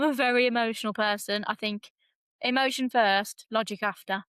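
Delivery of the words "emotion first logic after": usual speaking voice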